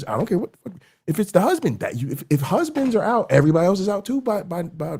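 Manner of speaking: high, strained, exasperated plea; worked-up, high-pitched warning; impassioned urging. Real, not acted. Pleading urgently that husbands aren't exempt.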